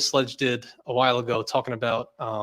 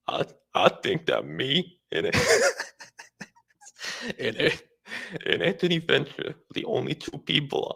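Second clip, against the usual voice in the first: Sledge impression. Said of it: "You sound something like".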